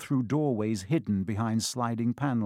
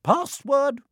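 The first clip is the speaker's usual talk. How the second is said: high, lilting, louder demand; portrait lady; 14 semitones higher, 5 dB louder than usual. A narrator's reading. The portrait of a fat woman asking Percy, high-voiced demand. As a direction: sing it out well above your usual pitch.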